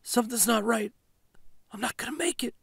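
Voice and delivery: high, weak, breathy pleading; fading wife whispering she can't make it; faint and breathy. Acted. Wife in the back seat, barely audible. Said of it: "Her voice was low, breathy".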